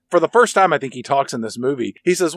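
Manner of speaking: ordinary talk, nothing marked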